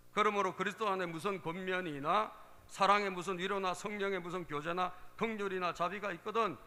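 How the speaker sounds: loud, measured Bible reading; scripture reading. Acted. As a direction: read the verse out to the congregation. Reads scripture aloud to congregation. (힘주어 성경 봉독하듯)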